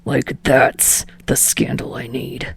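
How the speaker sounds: slow low whispered scorn; mild disgust, whispered low. A narrator's reading. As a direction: sneer it slowly, half under breath. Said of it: "Bakugo spits out, disgusted".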